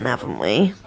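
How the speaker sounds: cooing at the dog; talking to the dog. Real, not acted. Baby talk to the dog.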